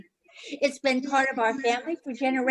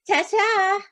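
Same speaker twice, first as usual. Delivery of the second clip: sing-song bye; 10 semitones higher, 6 dB louder than usual. Acted. Hostess leaving.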